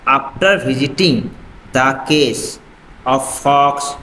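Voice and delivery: slow, word-by-word reading of an English text; slow reading aloud. Acted. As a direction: read it out slowly for the class. Teacher reads English passage aloud. (धीरे-धीरे पढ़कर सुनाना)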